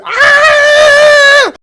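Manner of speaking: very high, loud sustained shriek; long scream. Acted. Game master acts out the orb attack, long scream. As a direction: hold a piercing scream.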